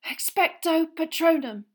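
high, loud strained spell; desperate incantation. A narrator's reading. Harry blinded by fog, desperately shouting. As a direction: force the spell out loudly. Harry casts amid distant screaming.

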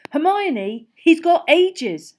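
loud, high, exasperated protest; snapped. A narrator's reading. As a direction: drag it out loudly in irritation. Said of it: "snapped Ron".

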